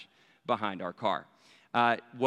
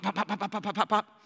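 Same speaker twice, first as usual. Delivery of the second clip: rapid-fire popping noises, pitched up; quick staccato pops in a higher voice; imitated firecrackers; 6 semitones higher than usual. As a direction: mimic a string of firecrackers going off. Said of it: "the ones that just go pop".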